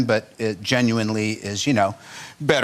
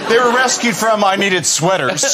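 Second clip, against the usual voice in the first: laughing; 11 semitones higher, 4 dB louder than usual. Real, not acted. Joking about sheared llamas.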